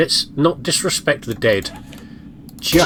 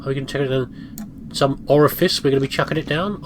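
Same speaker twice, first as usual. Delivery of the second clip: ordinary talk, nothing marked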